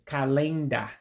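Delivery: "calenda" as kah-LAYN-dah, middle syllable stressed; modified English word. Shown as a demonstration. Teacher models Igbo form of calendar, stretched middle syllable. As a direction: say "calenda" with long A and no final R. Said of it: "a modified way of the".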